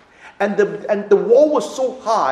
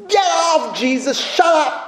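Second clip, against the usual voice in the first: higher, annoyed shout; dismissive outburst; 10 semitones higher than usual. Retold or imitated. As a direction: brush someone off with a mock outburst. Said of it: "And we say".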